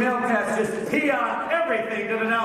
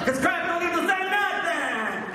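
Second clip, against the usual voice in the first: De Niro crab; 8 semitones higher than usual. Retold or imitated. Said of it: "my impression of Robert De Niro as a crab".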